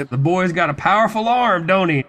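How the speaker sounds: a hint of a boastful old-school dad; proud bragging dad. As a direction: brag about your boy's arm. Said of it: "bragging to everybody".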